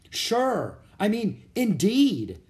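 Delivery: high, bright burst of eagerness; eager. A narrator's reading. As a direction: blurt it out with excitement. Annie eager to watch.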